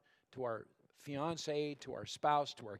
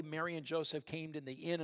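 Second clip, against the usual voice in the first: ordinary delivery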